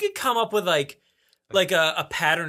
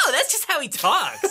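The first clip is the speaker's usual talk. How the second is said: high-pitched, exaggerated dawning understanding; hammed-up "oh, I get it" voice; mock realization; 9 semitones higher than usual. Host voicing the other person's head, hammed-up realization. Exaggerated retake of listener's thought.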